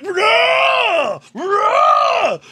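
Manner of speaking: high, loud mock roar; hammed-up monster rage scream; Hulk roar. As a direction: bellow a long wordless "rah". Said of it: "the Incredible Hulk comes out of me".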